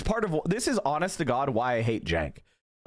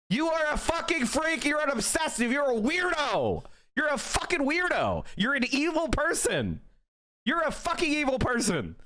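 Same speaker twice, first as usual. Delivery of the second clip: angry rant; 11 semitones higher than usual. Real, not acted. Angry rant insulting critics.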